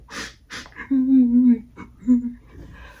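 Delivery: a long, high, loud sighing wail; heavy crying sigh. Real, not acted. Telling her friend about the day, a loud crying sigh. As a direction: let out a shaky crying sigh.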